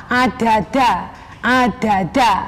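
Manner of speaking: slow, low, heavy Javanese "adada"; medok Javanese "adada". Shown as a demonstration. Teacher demonstrates the wrong medok "adada" to students. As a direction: drag out "adada" in medok style. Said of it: "dimedokkan bunyinya seperti ini".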